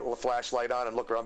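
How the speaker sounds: ordinary talk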